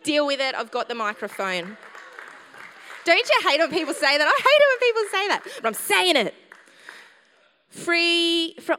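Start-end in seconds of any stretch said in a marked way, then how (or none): 3.0-8.8 loud, higher, fired-up preaching; passionate. Real, not acted. Preacher getting passionate with the microphone, loud and high. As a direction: let the passion pour out. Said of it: "I'm getting passionate now".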